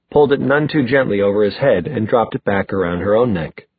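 usual speaking voice

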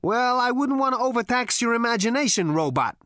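brash, swaggering space rogue; cocky Zaphod. A narrator's reading. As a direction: sneer it at the robot. Zaphod's character voice.

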